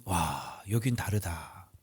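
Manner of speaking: soft, slow, quietly amazed; a hushed, slow wow of wonder; imitating a churchgoer who is quietly impressed; hushed awe. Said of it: "알아듣는 사람들은".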